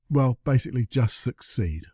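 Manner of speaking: ordinary talk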